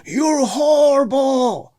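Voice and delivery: high, slow, drawn-out yell across the field; heckler yelling. Retold or imitated. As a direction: stretch out the insult like a fan jeering. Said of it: "he keeps yelling at me".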